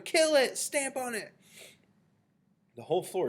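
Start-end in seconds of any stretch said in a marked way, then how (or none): 0.0-1.3 high, frantic yell easing at the end; shouting. A narrator's reading. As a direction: urge the mob on at the top of the voice. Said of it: "they were shouting".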